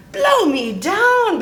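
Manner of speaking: high, drawn-out pirate exclamation; pirate bellow. A narrator's reading. Pete hears unpiratical talk, outraged high bellow. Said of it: "bellowed Pete".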